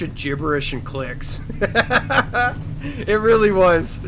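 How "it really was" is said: laughing. Real, not acted.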